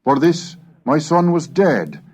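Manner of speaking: ordinary talk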